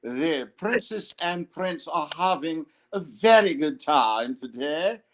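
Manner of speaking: lightly posh British gent voice, put on for the bit; British accent. Host mimicking royal commentary in a British accent. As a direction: play a mock British gentleman. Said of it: "he does the British accent with all of his videos".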